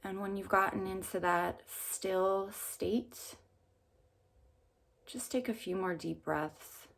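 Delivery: calm meditation guide with long pauses; unhurried phrases, leaving room to breathe between them; guided meditation. Real, not acted.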